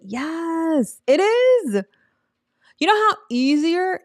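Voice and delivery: bursts up high and loud in delight; excited. Real, not acted. Excited yes to her viewers. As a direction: cheer it on, thrilled.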